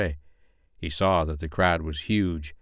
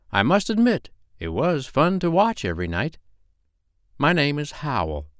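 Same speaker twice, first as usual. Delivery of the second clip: high-pitched, self-important owl; owl character; 7 semitones higher than usual. Acted. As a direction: speak as a storybook owl introducing himself. Narrator voices the owl.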